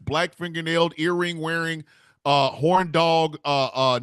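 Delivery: ordinary talk, nothing marked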